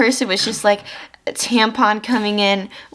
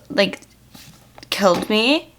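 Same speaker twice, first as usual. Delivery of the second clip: ordinary talk, nothing marked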